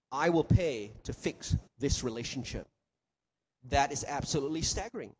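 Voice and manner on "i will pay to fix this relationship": gentle promise. Acted. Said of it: "The gospel says".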